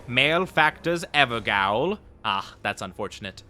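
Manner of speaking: ordinary talk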